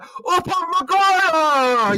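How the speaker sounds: very high, slow stretched-out name; commentator yell. Retold or imitated. Retelling the clip, hammed-up commentator yell, very high and slow. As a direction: belt the name like a stunned commentator. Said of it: "he's just like".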